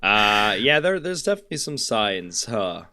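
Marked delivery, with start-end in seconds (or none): none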